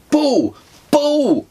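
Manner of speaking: high, loud alarmed shout. A narrator's reading. Dad warning his son of the bull, loud shout. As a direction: yell the warning as you run. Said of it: "I shouted".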